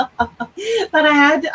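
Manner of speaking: laughing through the words. Real, not acted. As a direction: chuckle as you say it. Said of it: "laughing while telling".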